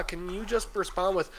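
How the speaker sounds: ordinary delivery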